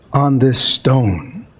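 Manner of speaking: expressive storybook narration; story reading. A narrator's reading. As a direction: read it like a classic tale by the fire. Reads Christmas Carol aloud.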